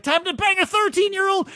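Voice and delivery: high, eager gloating shout; gleeful mock cheer. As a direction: cheer it like you've got permission. Gleeful retold quote.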